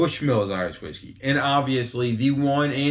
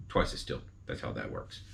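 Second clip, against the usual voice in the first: soft unvoiced whisper to himself; muttered under the breath; whispered aside; 12 semitones lower, 12 dB quieter than usual. Real, not acted. Whispered aside after explaining.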